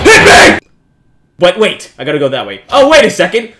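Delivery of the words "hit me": high, loud taunting yell; scared bravado yell; defiant shout. Real, not acted. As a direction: dare it to hit you. Taunting the ghost.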